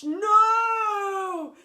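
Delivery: high, loud desperate cry; cried no. Acted. Teacher flushed down toilet, desperate cry. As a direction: cry out as you're sucked down. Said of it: "cried Mister Phoebe".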